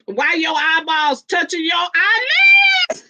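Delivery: the eyeballs line yelled high through laughter; zawołaj głośno i wysoko przez śmiech; loud teasing shout. Real, not acted. First loud take of the tease.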